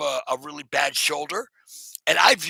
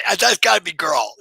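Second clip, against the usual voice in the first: louder, eager guess; excited guess; 4 semitones higher, 9 dB louder than usual. Real, not acted. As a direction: lean in, thrilled. Guessing who joined, excited.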